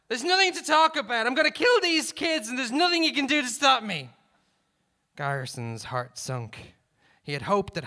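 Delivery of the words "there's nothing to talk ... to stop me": high, loud hostage-taker's threat; shouting back. A narrator's reading. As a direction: yell it back in defiance. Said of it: "shouted back".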